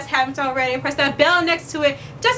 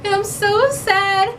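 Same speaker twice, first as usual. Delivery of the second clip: mock whine; 6 semitones higher than usual. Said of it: "be like".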